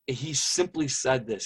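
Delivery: normal talking voice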